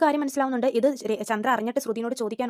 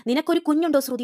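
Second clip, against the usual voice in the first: louder, higher accusing question; angry question; 4 semitones higher, 7 dB louder than usual. Retold or imitated. Aunt demanding whether Shruthi has a child, angry. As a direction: fire the question at her in anger. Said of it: "ചോദിക്കുന്നുണ്ട് ദേഷ്യത്തിൽ തന്നെ".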